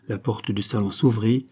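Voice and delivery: ordinary delivery, nothing marked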